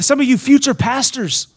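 usual speaking voice

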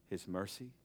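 usual speaking voice

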